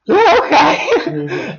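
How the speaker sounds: high, very slow laughing yeah okay; eager guy giggling yes; laughing agreement. Retold or imitated. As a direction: drawl it out through a laugh. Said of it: "I'm like".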